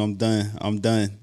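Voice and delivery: soft resigned done. Retold or imitated. Claiming to be done with him.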